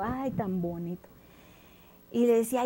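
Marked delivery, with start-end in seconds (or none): 0.0-1.1 soft dreamy murmur, lower and quieter; enchanted sigh. Acted. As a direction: sigh like a charmed giant. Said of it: "abría y cerraba el ojito". (suspiro embelesado y suave)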